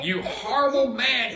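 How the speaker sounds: high, loud cry of outrage; high-pitched indignant cry. A narrator's reading. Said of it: "cried my Lady Melissa".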